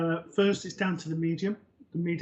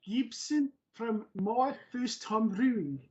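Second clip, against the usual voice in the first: awkward, higher-pitched stab at South African; bad South African accent; 3 semitones higher than usual. Shown as a demonstration. Host shyly obliging co-host's dare, bad South African accent. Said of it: "it was meant to be my attempt at South African".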